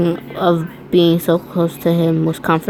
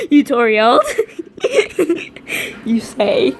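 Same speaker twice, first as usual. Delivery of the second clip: high cracking laughter through the words; laughing fit; 9 semitones higher than usual. Real, not acted. Reader laughing at misread name, high giggling. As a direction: laugh at the slip.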